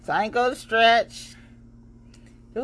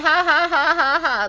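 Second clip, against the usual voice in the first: higher, louder sarcastic mock laugh; fake laugh; 7 semitones higher, 5 dB louder than usual. Host mocking Facebook posters with a fake laugh. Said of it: "Oh, and I said".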